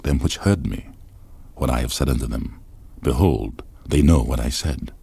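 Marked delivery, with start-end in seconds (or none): none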